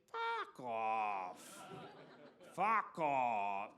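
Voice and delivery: a hint of a small child copying dad; toddler's swearing. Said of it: "From the back seat".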